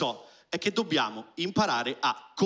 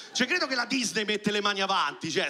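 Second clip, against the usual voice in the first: usual speaking voice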